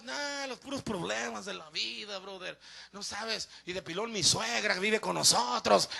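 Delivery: soft, downcast grumbling about troubles; hammed-up weary moaning to a buddy; traumatized complainer. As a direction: imitate someone worn down by life. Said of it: "Todo traumado".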